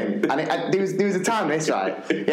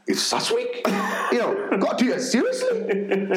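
higher, put-on earnest voice; earnest PE teacher; 8 semitones higher than usual. PE teacher defending his seriousness in Sats week, high earnest insistence. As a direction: insist it matters, high and urgent. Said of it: "he was going like".

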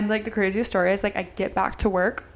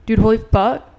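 louder, half-shouted disbelief from the friend; excited yell; 8 dB louder than usual. Retold or imitated. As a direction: blurt it out in a rush of shock. Friend freaking out, guy chasing.

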